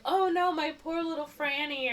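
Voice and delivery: high, hammed-up pity; fussing mock parent. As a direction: coo with fake worry into the phone. Acted worried parent on phone.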